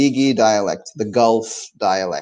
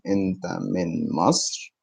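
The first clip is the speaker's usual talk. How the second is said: "أنت من مصر" slowed down, softer and lower; 4 semitones lower, 6 dB quieter, faster than usual. Shown as a demonstration. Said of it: "Are you from Egypt?".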